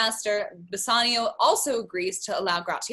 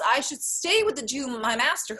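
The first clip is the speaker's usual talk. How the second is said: usual speaking voice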